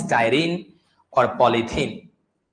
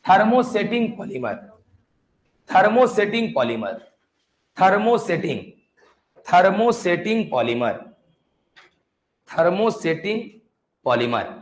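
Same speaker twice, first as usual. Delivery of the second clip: syllable by syllable, drawn out; slow dictation; much slower than usual. Shown as a demonstration. Teacher repeating the term slowly for students' notes. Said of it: "लिखो".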